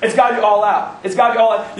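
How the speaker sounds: usual speaking voice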